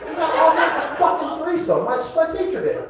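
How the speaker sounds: high-pitched comic outrage; indignant disbelief. Acted. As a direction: squeal it, scandalized at being passed over. Indignant repeat, he's the headliner.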